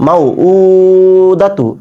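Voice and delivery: মাউ উ দাতু with quick মাউ and long held উ; short মাউ, stretched উ, quick দাতু; selective elongation. Shown as a demonstration. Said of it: "তাই টান দিব না". (মাউ ছোট, উ টেনে, দাতু ছোট)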